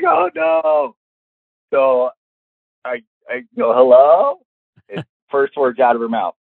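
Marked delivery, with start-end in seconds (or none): 0.0-1.0 high, wailing dismay; mock dread. Man learning the angry mother is calling, mock-dread wail. 3.7-4.4 raised, louder, questioning hello; nervous greeting. Retold or imitated. Man taking the dreaded phone call, nervous hello.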